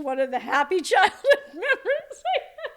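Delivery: high, laughing through the words. Real, not acted. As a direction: break up laughing as you speak. Laughs at her own grim memory.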